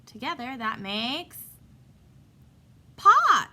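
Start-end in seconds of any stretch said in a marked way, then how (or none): 2.9-3.5 excited. Real, not acted. Triumphant blended word reveal.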